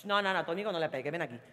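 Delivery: quiet quick aside. Retold or imitated.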